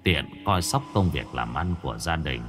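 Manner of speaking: low, gentle dying elder brother; softly entrust the family; ghost brother's last wishes. A narrator's reading. Dead brother entrusts family to younger brother, low and gentle.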